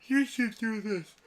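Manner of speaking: high, loud, slow tempting voice; high, loud voice of a tempting inner thought; brain's bad idea. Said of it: "my brain picked up and was like".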